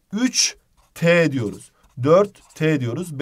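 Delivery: "üç T", "dört T" said slowly, with the suffix as a crisp hard t. Shown as a demonstration. Teacher to exam students, slowly stressing the hard t. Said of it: "Ünsüz benzeşmesi bu rakamlarda var".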